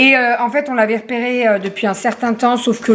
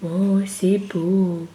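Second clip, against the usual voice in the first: soft cooing; 5 semitones lower, 6 dB quieter, much slower than usual. Real, not acted. Mother admiring the bow.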